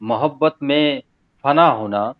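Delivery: emphatic unsung ghazal recital; spoken verse recitation. Acted. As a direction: declaim the couplet with rising, lingering phrase ends. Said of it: "मैं तहत में ही पढ़ता हूँ".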